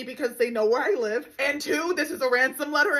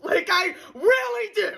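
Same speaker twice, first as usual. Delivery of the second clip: frustrated yell; 8 semitones higher than usual. Acted. Frustrated dating rant.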